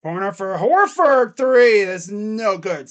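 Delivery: lightly voiced announcer call; live play-by-play. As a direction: calmly call the possession. Streamer calls the game live.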